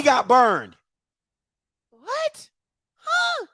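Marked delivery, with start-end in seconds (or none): 1.9-3.5 sharp high What and Huh; disbelief. Real, not acted. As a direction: squeak out baffled disbelief. Baffled by the play.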